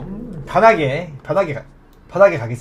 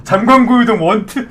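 ordinary delivery

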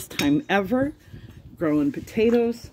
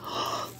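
sharp gasp; 12 dB quieter than usual. Real, not acted. Surprise at big potato harvest.

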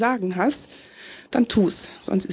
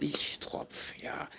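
breathy self-mocking whisper, much softer; whispered aside; 7 semitones lower, 15 dB quieter, slower than usual. Slam poet mocking herself in a whispered aside. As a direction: drop to a whisper to call yourself a fool.

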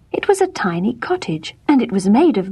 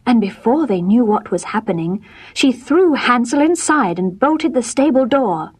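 ordinary delivery

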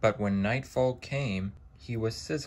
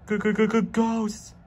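stammering shriek, much higher and louder; terrified stammer; 13 semitones higher, 6 dB louder than usual. A narrator's reading. Henry spotting a light, stammering in terror. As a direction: stutter out the word in sheer fright. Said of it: "exclaimed Henry".

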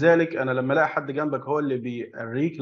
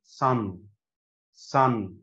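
sun said slowly with a clear S; slow model word. Shown as a demonstration. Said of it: "فهتنطق دي".

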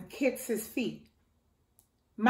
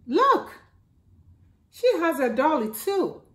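excited look; 6 semitones higher, 3 dB louder than usual. Acted. Character points out dolly.

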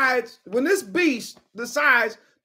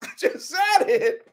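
laughing; 7 semitones higher than usual. Real, not acted.

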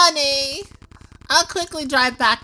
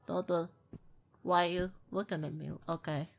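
fast, much softer and lower; hushed nervous talk; 8 semitones lower, 11 dB quieter, faster than usual. Real, not acted. Spooked by a stranger staring, hushed and quick. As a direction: keep it under your breath, uneasy. Spooked, talking under his breath.

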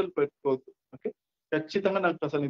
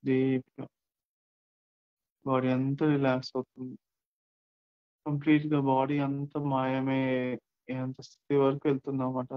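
slow, drawn-out countdown with long silences; guided meditation; 3 semitones lower, slower than usual. Acted. Count listeners down into deep relaxation, guided meditation. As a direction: stretch the words to sink them deeper.

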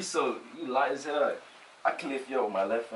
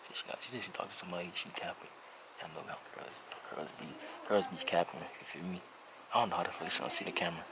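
whisper; 5 semitones lower, 8 dB quieter than usual. Real, not acted. Secret commentary to viewers.

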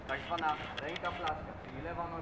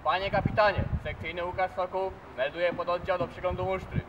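military report; 6 dB louder than usual. Real, not acted. Formal report to captain.

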